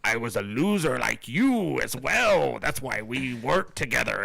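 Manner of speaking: slow, lightly voiced Venom; imitating Venom. Insisting to the host that Venom really says it this way, slow and mock. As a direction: drawl it out as a mock monster. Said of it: "That's how he says it".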